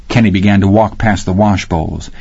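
ordinary delivery, nothing marked

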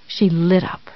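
usual speaking voice

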